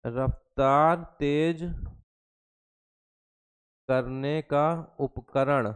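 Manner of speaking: measured, drawn-out dictation with long pauses; slow dictation. Real, not acted. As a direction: spell it out slowly, word group by word group. Dictating slowly while writing it out.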